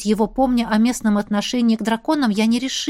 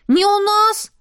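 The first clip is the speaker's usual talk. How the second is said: put-on high, outraged kid voice; indignant child; 10 semitones higher, 4 dB louder, slower than usual. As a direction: protest like an offended boy. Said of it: "возмутились мальчики".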